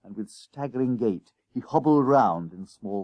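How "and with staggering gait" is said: ordinary delivery, nothing marked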